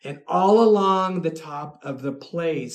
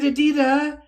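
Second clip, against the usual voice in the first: high, louder exclamation of her name; retold amazement; 10 semitones higher, 6 dB louder than usual. Fan sees the Lugosi photo, high retold amazement. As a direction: call her name in reenacted astonishment.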